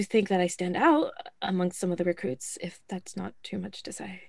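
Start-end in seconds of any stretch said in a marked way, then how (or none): 2.6-4.2 whispered aside. Acted. Recruit whispering a modest aside to the officer. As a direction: whisper it, unvoiced and hushed. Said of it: "nervous aside to officer".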